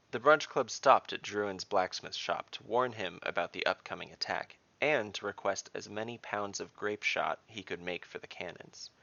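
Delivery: ordinary delivery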